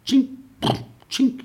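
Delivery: high, loud clinking sound; medal clink. Retold or imitated. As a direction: mimic medals clinking on a chest. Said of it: "we went".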